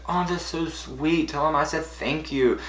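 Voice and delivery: high, soft, drawn-out sweetness; imitated sweet gratitude; touched. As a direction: coo it gently up high. Star touched by grandparents' help.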